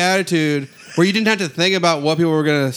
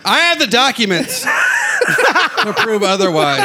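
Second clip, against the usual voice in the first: shocked laughter; 7 semitones higher, slower than usual. Real, not acted. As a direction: laugh through the comeback.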